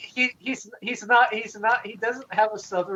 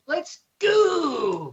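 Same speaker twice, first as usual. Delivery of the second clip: high, drawn-out hype call; Tom; 7 semitones higher than usual. Retold or imitated. Friend imitating how the DJ does it, high stretched shout. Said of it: "I like how Tom does it".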